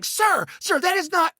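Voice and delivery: high, prim mock of a stern gate agent; mocking the airline agent. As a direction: lightly imitate the fussy agent correcting him. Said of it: "she's like".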